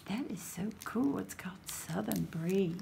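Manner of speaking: soft whisper of admiration; softly whispered delight; hushed whisper. Real, not acted. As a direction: whisper it like a secret treasure. Admiring the tea gift.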